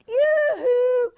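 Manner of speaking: high, loud sing-out call; yelled greeting. Retold or imitated. Aunt calling to her nephew from the driveway, joyful yell. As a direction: holler it up a long driveway. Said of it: "I would yell out".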